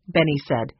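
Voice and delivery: ordinary delivery, nothing marked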